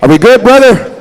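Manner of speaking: calling out. Real, not acted. Emcee calls out to the drum group to check they're ready, loud. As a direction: shout over to the drum.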